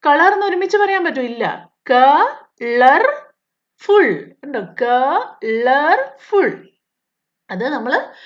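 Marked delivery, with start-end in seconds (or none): 1.9-4.3 colourful said slowly as ക, ളർ, ഫുൾ; syllables split. Shown as a demonstration. Teacher splitting colorful for pupils to try, slow syllables. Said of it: "സ്വന്തമായിട്ടൊന്ന് പറയാൻ ശ്രമിച്ചു നോക്കിയേ". 4.8-6.7 colourful said slowly as ക, ളർ, ഫുൾ; syllables split. Shown as a demonstration. Teacher splitting colorful for pupils to try, slow syllables. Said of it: "സ്വന്തമായിട്ടൊന്ന് പറയാൻ ശ്രമിച്ചു നോക്കിയേ".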